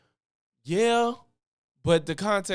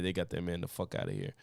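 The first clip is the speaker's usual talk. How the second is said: usual speaking voice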